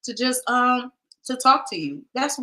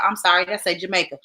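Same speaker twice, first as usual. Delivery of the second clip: normal talking voice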